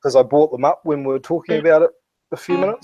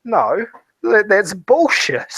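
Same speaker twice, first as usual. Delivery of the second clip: higher, slowed, scornful "No, that's bullshit"; scoffing dismissal; 7 semitones higher, slower than usual. Retold or imitated. Retelling reading bogus health claims, scoffing slowly. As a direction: drag it out in disbelief, mocking the claim. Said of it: "I was like".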